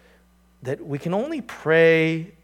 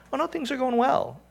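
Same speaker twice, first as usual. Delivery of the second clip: fake cheerful reply; 12 semitones higher than usual. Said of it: "when they ask us questions".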